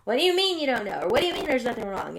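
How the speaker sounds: imitating her old exasperated self demanding answers; agitated. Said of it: "I'd get agitated".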